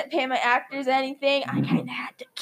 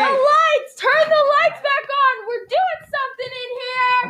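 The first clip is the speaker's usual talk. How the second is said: calling out; 8 semitones higher, slower than usual. Acted. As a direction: yell for someone to fix the lights. Calling out in the dark.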